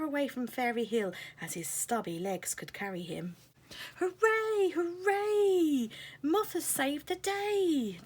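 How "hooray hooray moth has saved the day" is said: high, loud joyful cheer. Acted. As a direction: cheer the hero. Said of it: "the fairies cheered".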